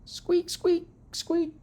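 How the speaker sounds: very high-pitched little squeaks; hamster squeaks. A narrator's reading. Classmates hearing the hamster's shout, just squeaks. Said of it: "all my friends heard was".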